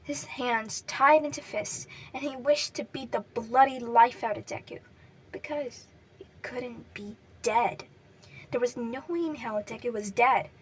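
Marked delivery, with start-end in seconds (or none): none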